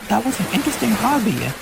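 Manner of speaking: high, quick curious remark; curious girl. Acted. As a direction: marvel at a new hobby. Elita's voice, thinking.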